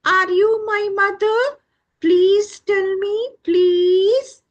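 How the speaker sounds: high, loud begging chick voice; pleading chick. Acted. Chick asks the goat if it is its mother, high pleading.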